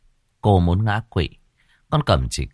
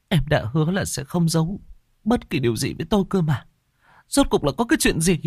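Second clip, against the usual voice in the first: higher-voiced, urgent mistress; anxious young mother; 8 semitones higher, faster than usual. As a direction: press the maid to bring the baby. Mother presses the maid.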